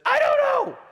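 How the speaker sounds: high, loud mock-wailing child; tearful outburst. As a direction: imitate a child bursting into tears, shrieking. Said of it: "she just burst into tears and said".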